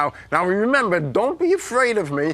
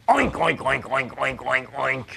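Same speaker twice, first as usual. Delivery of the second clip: low pig grunts, getting softer; pig oinking; 10 semitones lower, 4 dB quieter than usual. Acted. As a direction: oink like a pig, low and repeated. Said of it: "Does the wolf sound like this?".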